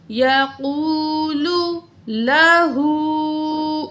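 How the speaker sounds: یقولو لہو sung slowly with long vowels; stretched recitation. Shown as a demonstration. Teacher chanting the phrase slowly as a model. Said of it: "کھینچ کے پڑھیں گے".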